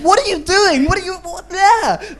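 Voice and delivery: high, outraged mock German yelling; imitating an indignant German pedestrian; mock angry German. Germans scolding a jaywalker, hammed-up outraged yelling. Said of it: "the German people go crazy".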